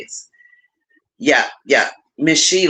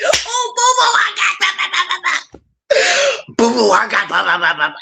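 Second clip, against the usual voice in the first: put-on much higher, bursting, kid-like squeal; retelling a giddy call to her daughter; giddy kid on Christmas; 11 semitones higher than usual. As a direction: gush like a kid opening presents. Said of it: "like a big ass kid getting what they wanted on Christmas morning".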